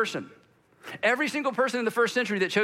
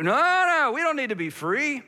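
insistent protest; 7 semitones higher, 3 dB louder than usual. Retold or imitated. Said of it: "They're like".

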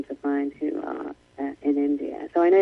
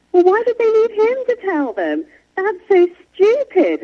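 put-on high, slow, incredulous child voice; mock childlike disbelief, drawn out; imitating her son; 4 dB louder than usual. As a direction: play the bewildered nine-year-old. Son's reaction, retold by mother.

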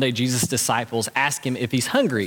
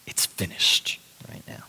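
quiet triumph; 6 semitones lower, 4 dB quieter than usual. Acted. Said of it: "It's not a sigh of despair".

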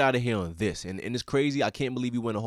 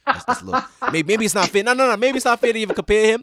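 excited; 11 semitones higher, 10 dB louder than usual. Real, not acted. Laughing self-correction about unfair comparison.

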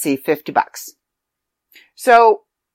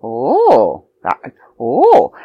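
awed reader; 7 semitones lower, 4 dB louder, much slower than usual. Retold or imitated. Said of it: "you're reading it and you're going".

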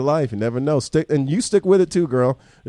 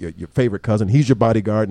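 ordinary talk, nothing marked

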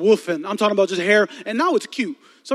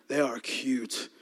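lower, softer and slower dreamy echo; hushed admiring; 8 semitones lower, 8 dB quieter, much slower than usual. Shown as a demonstration. Repeating it to the crowd, softer and dreamier. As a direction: sigh it out, lost in admiration.

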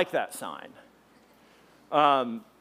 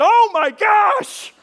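high, slow, loud shocked cry; a drawn-out shriek of surprise; shocked shout; 11 dB louder, much slower than usual. Retold or imitated. Preacher retelling his shock, shrieking high and slow. Retold own shocked exclamation.

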